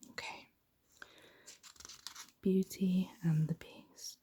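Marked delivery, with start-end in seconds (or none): none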